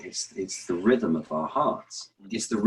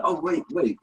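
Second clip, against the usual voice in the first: high, startled audience reaction; mock surprised audience; 8 semitones higher, 4 dB louder than usual. Audience startled by Shakespeare's broken rhythm, high. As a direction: catch the odd beat in surprise. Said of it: "they would be like".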